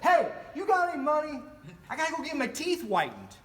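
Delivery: high and loud hustler's pitch; panhandler's voice. Retold or imitated. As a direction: ask for money brightly, up high. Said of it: "He's like".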